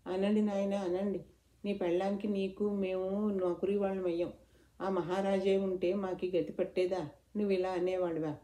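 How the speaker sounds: plain reading voice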